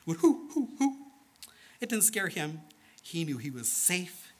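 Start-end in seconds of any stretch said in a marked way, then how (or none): 0.2-1.0 high, hooting owl call; owl hoots. Retold or imitated. Storyteller voicing the night owls in the dark, hooting. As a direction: imitate a little night owl. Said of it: "the little night owls would".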